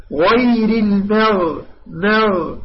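slow careful recitation, full غ in المغ; heavy ghayn. Shown as a demonstration. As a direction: hold the heavy مغ. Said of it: "لا تقول مغ".

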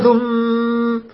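slow, drawn-out ghunnah closing ثم; doubled meem in ثم. Shown as a demonstration. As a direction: stop with a long hum on meem. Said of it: "هكذا تقف عليها".

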